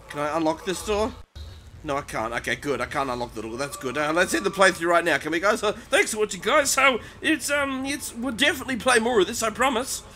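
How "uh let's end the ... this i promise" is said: high, loud, nervous mock outro; panicky sign-off. Gamer to viewers, trying to end the playthrough, panicky. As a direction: rush to wrap up, voice climbing high. Jokily trying to quit scary game.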